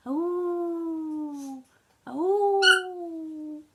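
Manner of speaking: high howl coming nearer; answering wolf howl. Acted. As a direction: howl back from close by. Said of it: "again the reply came, nearer this time".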